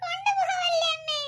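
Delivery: put-on high, slow, whimpering child's plea; a small boy sobbing as his pets are taken; crying little boy. Young son tearfully begging mother to keep the birds, slow high whimper.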